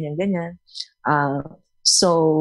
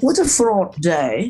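slow, plummy British drawl; a Crown-style aristocrat sighing; mock posh British; slower than usual. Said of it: "Parang nanonood ka lagi ng sine".

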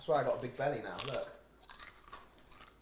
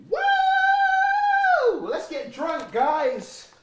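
excited cheer; 9 dB louder, much slower than usual. Real, not acted. Cheering before a shot.

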